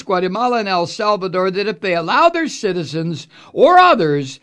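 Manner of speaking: high, loud mocking read of the tweet; mock Trump reading. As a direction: read it as a pompous Trump, jeering.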